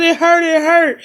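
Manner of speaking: retold hollering. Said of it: "I just started hollering".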